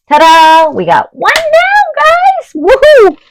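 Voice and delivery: high, loud ta-da celebration; excited cheer. Real, not acted. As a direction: cheer like you just won. Celebrating finishing first haul item.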